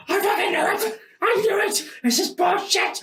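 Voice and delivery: high, strained mock outrage; hammed-up anguished crying out; dying on the cross. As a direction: wail it in agony from the cross. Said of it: "He's on the cross, just, just dying".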